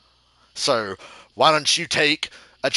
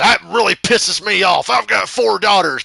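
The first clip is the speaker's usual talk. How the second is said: angry boss; 7 semitones higher, 6 dB louder than usual. Retold or imitated.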